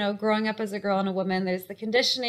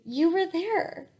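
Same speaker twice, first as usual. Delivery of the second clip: higher and slow, voice welling up as she remembers; choked up with emotion; 5 semitones higher, slower than usual. Real, not acted. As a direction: let the feeling catch in the throat, slowing down. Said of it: "it makes me really emotional".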